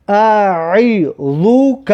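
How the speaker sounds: اعیذک drawn out with the ع from the throat; slow Arabic reading. Shown as a demonstration. Teacher showing pupils how to read the word, slow recitation. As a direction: sound out اعیذک slowly, throat on the ع.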